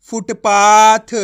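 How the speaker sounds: Footpath drawn out and loud; teacher announcing the joined word; slow loud blended word. Shown as a demonstration. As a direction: stretch footpath out for learners. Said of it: "same तरीका होता है".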